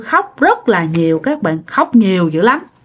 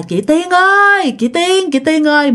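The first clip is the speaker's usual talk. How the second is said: ghostly calling; 7 semitones higher than usual. Retold or imitated. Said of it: "lại tiếp tục nghe rằng á là".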